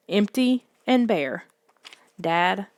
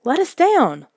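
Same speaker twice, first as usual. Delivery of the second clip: frightened cry; 8 semitones higher, 3 dB louder than usual. A narrator's reading. Kids carried by monster.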